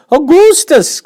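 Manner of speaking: very high, loud shout of the name; a mother shouting at her son. Acted. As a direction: yell it across the bank. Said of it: "shouted Missus Gloop".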